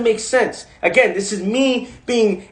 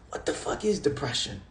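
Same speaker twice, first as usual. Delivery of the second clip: quiet; 6 semitones lower, 13 dB quieter than usual. Retold or imitated. Talking to himself in mirror.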